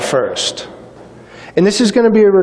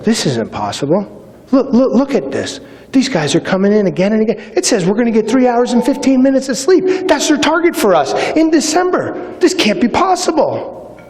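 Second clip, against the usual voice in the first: panicky recruit; 6 semitones higher than usual. Retold or imitated. Recruit spreading panic to classmates.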